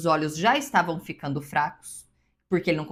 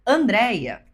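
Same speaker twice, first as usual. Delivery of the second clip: louder acted call of a name; husband calling out; 2 semitones higher, 5 dB louder, slower than usual. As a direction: call your wife through a crowd. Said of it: "se o meu marido, no meio de uma multidão, disser". (chamado do marido no meio da multidão, mais forte)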